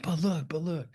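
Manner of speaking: whispered, urging; breathless urging whisper; excited whisper. Retold or imitated. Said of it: "And she's like".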